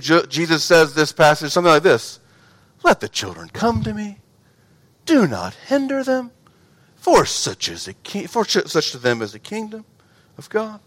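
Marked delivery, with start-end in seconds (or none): 2.8-10.8 quiet, tender, soft-spoken; soft gentle reading. Retold or imitated. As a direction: say it gently, almost hushed. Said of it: "No, it's, it's, it's more like my football coach voice".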